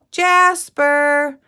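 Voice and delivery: put-on girl searching for her missing dog, calling out. A narrator's reading. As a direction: call loudly for the lost dog. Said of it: "she called".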